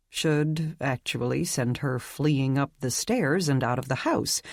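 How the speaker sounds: plain reading voice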